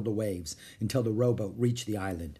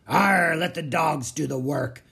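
slow, loud growl, pitched up; pirate growl; 4 semitones higher, 6 dB louder than usual. A narrator's reading. Captain scorning his digging crew, slow growling. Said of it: "growled Captain Bones".

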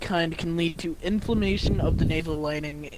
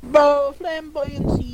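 high mocking voice; 8 semitones higher, slower than usual. Mocking word in put-on voice.

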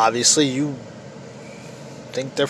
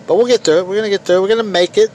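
rallying; 6 semitones higher, 5 dB louder, much faster than usual. Real, not acted. Rallying end of rant.

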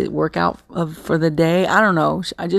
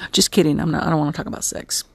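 ordinary talk

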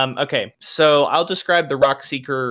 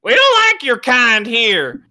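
a bar patron spits in the drink and runs off the city folk, shrieking; angry patron yelling; 11 semitones higher, 6 dB louder than usual. Acted. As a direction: scream him out of the bar, voice cracking high.

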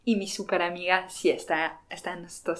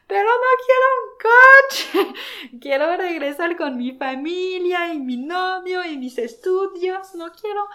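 very high, loud tearful wail, half laughing; mock weepy pleading that fades off; imitating sobbing friend; 10 semitones higher, 8 dB louder than usual. Retelling the crying friend wanting to go home, high tearful wail. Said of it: "Está llorando".

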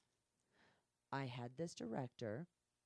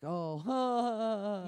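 loud, higher, drawn-out oh; caught out; 6 semitones higher, 10 dB louder than usual. Retold or imitated. Director caught red-handed by the performer, caught out. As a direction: imitate someone busted, one big exclamation.

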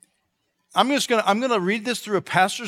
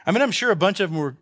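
ordinary delivery

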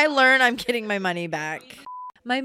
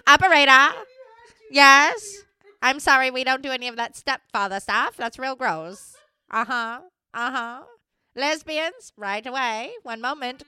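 lightly voiced perky operator, higher; 6 semitones higher, 6 dB louder than usual. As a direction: answer like a switchboard operator. Playing a phone operator.

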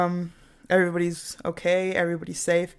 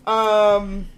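nervous laugh; 3 semitones higher, 4 dB louder than usual. Real, not acted. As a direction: laugh away the awkwardness. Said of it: "It's just a nervous laugh".

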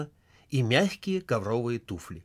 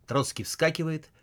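plain reading voice

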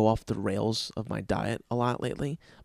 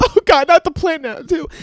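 high shrill mock girlfriend wail, much louder; high, loud mocking impression of her panic; exasperated girlfriend; 12 dB louder than usual. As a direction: squeal it like the upset girlfriend. Ex-girlfriend exasperated over plant.

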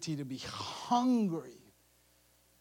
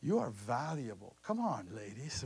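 usual speaking voice

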